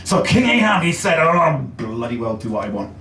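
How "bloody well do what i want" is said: ordinary delivery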